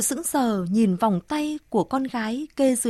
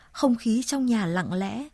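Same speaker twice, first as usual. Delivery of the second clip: ordinary delivery, nothing marked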